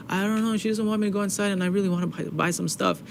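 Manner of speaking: high, small retold plea; plaintive child. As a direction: replay a hurt kid explaining. Retelling himself crying as a child.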